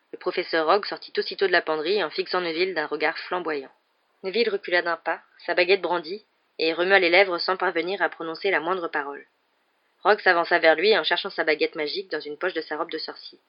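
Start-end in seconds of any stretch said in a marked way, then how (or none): none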